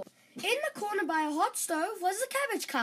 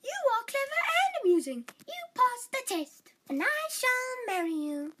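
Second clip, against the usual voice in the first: much higher, fast happy rush; delighted princess; 6 semitones higher than usual. Acted. Princess accepting Dan as husband, delighted and fast. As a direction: gush with delight.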